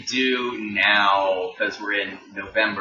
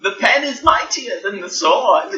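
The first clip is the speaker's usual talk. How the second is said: mock-heroic motto; 10 semitones higher, 3 dB louder than usual. Continues as the fantasy character.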